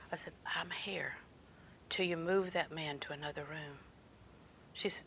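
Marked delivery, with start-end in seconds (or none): 0.1-3.8 very soft, lowered voice; quiet, firm demand in a near whisper; re-enacted whispered demand at the desk. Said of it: "it struck me that I was whispering".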